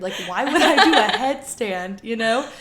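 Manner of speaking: loud, laughing disbelief. Retold or imitated. Friend laughing while retelling a girl's doubt. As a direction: laugh through the words at how silly it seems. Retold girl finds request absurd.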